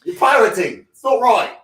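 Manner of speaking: loud, hammed-up British Navy officer in a clumsy English accent; bad British accent. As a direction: bark at the pirates in a terrible British accent. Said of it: "Your British accent is horrendous".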